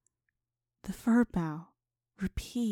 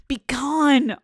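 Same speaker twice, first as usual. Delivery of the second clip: loud, higher, furious shout; 6 semitones higher, 11 dB louder than usual. Acted. Dreamer shouting at the ghost to leave, loud shout. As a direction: drive the ghost away with a shout. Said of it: "I shouted".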